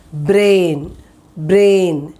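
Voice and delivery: brain with a clear long ay sound; pronunciation demo. Shown as a demonstration. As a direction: drill the word brain, said twice. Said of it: "Now you saw the sounds".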